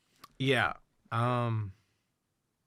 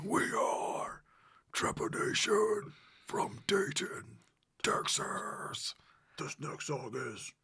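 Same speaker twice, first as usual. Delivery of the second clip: guttural growled stage announcement, slow and drawn out; death metal voice; 7 semitones higher, much slower than usual. Retold or imitated. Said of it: "in his, like, death metal voice".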